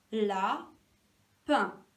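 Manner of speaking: "Lapin" in two beats, la, pin; a long gap between the syllables of "Lapin". Shown as a demonstration. Teacher checking the syllable count, syllable by syllable. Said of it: "dire les syllabes et les compter".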